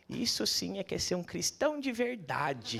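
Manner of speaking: slow, different-voiced elderly lady; approving old lady. Elderly woman praising the speaker, slow and approving. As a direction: deliver it like a wise old churchgoer. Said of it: "fala assim, pra mim".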